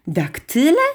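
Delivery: puzzled, rising repeat; surprised. A narrator's reading. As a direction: echo the word in mild astonishment. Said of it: "zdziwiła się Martynka".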